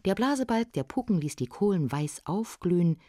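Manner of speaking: ordinary delivery, nothing marked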